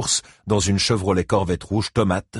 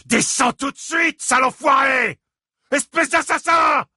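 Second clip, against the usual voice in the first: furious yelling; 4 dB louder than usual. A narrator's reading. Furious father yelling at Gérald to come down. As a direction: scream it up at the door.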